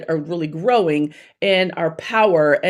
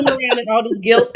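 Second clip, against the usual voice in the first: ordinary talk, nothing marked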